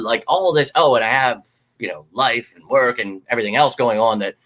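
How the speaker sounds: ordinary delivery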